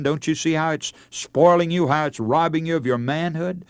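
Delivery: usual speaking voice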